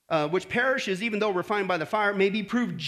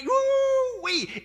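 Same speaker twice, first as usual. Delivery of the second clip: high, drawn-out holler; thrilled, stretched-out cry of joy; jubilant whoop; 14 semitones higher, 3 dB louder than usual. Real, not acted. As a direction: let out a long high whoop. Exults at guaranteed salvation.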